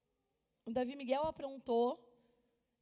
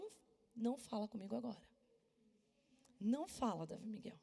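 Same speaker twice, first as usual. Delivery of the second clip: stern, quietly angry; 3 semitones lower, 8 dB quieter than usual. Retold or imitated. Furious mother silencing her son.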